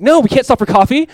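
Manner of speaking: loud, high exasperated refusal; exasperated shout. Retold or imitated. Frantic father refusing Jesus a coffee stop, loud and exasperated. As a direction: snap back loud and high at the suggestion. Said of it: "You're like".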